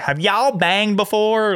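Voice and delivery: high, slow mock Wyoming drawl; Wyoming accent. Imitating a girl asking her parents, Wyoming accent. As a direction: stretch it out like a Wyoming kid. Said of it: "such a Wyoming accent".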